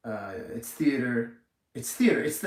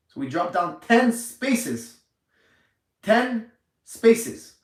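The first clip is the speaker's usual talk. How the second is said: outraged emphasis; 8 semitones higher, 7 dB louder, much slower than usual. Real, not acted. Outraged at the ranking drop.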